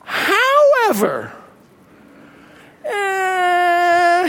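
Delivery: high, drawn-out, whimpering however; a mock-sad whine on however. Shown as a demonstration. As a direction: let the word sag into a sad groan. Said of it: "a sad face next to it".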